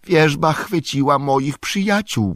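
high-pitched, alarmed outcry; urgent high cry for help; breathless cry. A narrator's reading. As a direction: blurt out the bad news in panic. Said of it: "krzyknął bez tchu Frodo".

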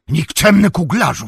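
high, loud outraged yell; wrzask. A narrator's reading. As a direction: scream an insult in fury. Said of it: "wrzasnął". (wysoki, wściekły wrzask)

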